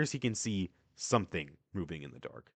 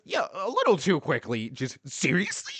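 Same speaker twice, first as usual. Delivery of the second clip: eager; 7 semitones higher than usual. Acted. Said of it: "Which Donji says".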